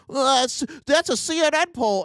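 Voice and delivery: high, whiny mock of a Trump fan. Comic mocking Trump fans to the crowd, high whiny mock voice. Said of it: "the same group who's like".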